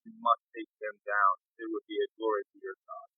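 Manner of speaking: solemn divine decree, hushed; booming voice. Acted. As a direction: intone it like a god in his head. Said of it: "I am booming a voice into his head".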